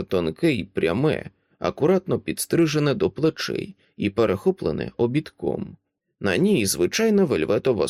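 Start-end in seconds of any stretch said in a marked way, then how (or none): none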